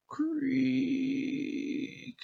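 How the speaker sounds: very high, long drawn-out creak; soft squeaky hinge sound; creaking door. Acted. Door opening slowly.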